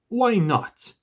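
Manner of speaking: loud, high burst of indignation; angry cry. A narrator's reading. Bilbo snapping back at Gandalf about the ring, angry cry. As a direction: cry it out sharply, affronted. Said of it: "he cried".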